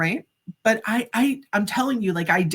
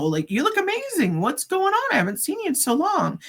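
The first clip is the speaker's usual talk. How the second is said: much higher, delighted surprise; amazed; 10 semitones higher than usual. Retold or imitated. Greeting a transformed old friend, high amazed delight. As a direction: gush at how great he looks.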